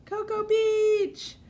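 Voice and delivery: stretched happy whoop; excited shout-out. Real, not acted. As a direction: call it out like great news. Cheering a viewer's location.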